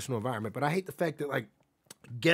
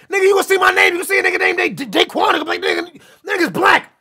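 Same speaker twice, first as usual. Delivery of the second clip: fired-up yell; 13 dB louder than usual. Real, not acted. Streamer shouting his point at chat, fired-up yell.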